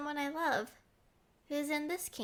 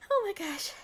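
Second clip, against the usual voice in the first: breathless rush; 7 semitones higher, 4 dB louder than usual. Acted. Breathless after the chase.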